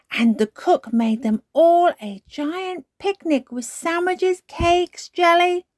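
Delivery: put-on high, loud child storyteller; excited listing. A narrator's reading. Peppa listing all the foods for her story, excited. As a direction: reel off treats with mounting glee. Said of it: "listed all the foods".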